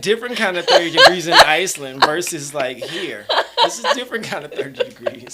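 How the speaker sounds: words bubbling through real laughter; laughing while talking. Real, not acted. Guest explains the Iceland cold to the host, laughing.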